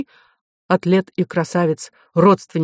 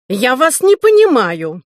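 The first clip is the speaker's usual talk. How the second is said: peevish professor; 8 semitones higher, 6 dB louder than usual. A narrator's reading. Offended professor answers Arthur.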